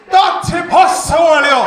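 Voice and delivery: high, loud, drawn-out holler from a balcony; retold shout. Comic shouting his line over a balcony, loud high holler. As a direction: bellow it out, high and slow. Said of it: "I shouted it out".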